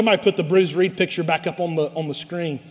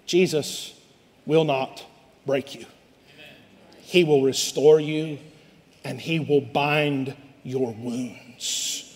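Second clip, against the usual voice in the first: tender reassurance; 4 semitones lower, 5 dB quieter, slower than usual. Real, not acted. Tender reassurance after quoted complaint.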